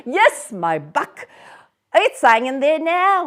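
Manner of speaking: loud high lad's reply; street boy. Acted. The boy answering Scrooge from the street, cheeky. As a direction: call back up cheekily.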